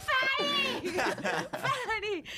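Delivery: high laughing yell of an insult; taunting shout. Retold or imitated. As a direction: hurl it after her, cackling. Yelling insult after her.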